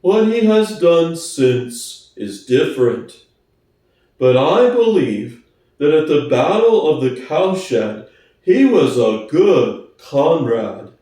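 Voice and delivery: plodding, honest workhorse. Acted. Boxer voiced low.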